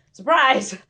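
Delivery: playful surprise. Real, not acted. Teasing the pets about baths.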